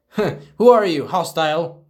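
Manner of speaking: high, loud startled challenge; mock alarm. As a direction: jump and demand who it is. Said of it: "And they're like".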